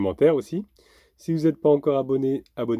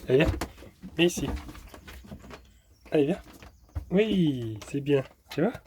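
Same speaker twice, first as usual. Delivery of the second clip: higher, coaxing then praising; talking to a dog; 4 semitones higher than usual. Real, not acted. (voix câline pour le chien)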